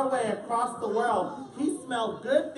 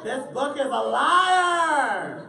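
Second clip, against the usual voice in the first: slow, louder accusation; mock outrage; 3 semitones higher, 6 dB louder, much slower than usual. Reader jokingly accusing the book after the hot supper. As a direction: pretend to be betrayed. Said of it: "joking outrage".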